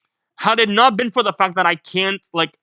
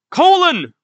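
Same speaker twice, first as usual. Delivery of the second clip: anguished shout; 12 semitones higher, 7 dB louder than usual. Acted. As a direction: cry out a name, high and loud. Acting a desperate cry of name.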